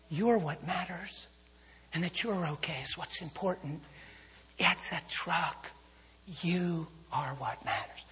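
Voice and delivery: slow, soft and tender, each word weighed; calm her down gently like a pastor; slow tender reassurance. Retold or imitated. Preacher to shaken driver after the crash, slow tender reassurance.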